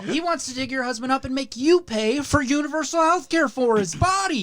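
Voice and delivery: high, bright fake sympathy; chirpy telemarketer. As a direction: sympathize then pivot to the sale. Telemarketer pitch continues.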